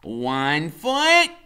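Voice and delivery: guiding each step like a game; Freak's voice. A narrator's reading. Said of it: "he says".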